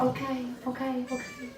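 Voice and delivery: high, soft, helpless yeses rising very high; mock pushover agreeing to anything; meek giving in. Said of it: "and you'd say".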